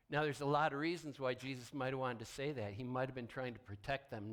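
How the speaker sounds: usual speaking voice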